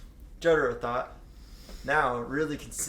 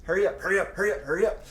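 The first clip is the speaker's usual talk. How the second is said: higher, louder eager chant; eager repetition; 6 semitones higher, 5 dB louder, much faster than usual. Acted. Jotaro impatient in his futon, eager higher chant. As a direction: bounce through it impatiently. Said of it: "He repeated".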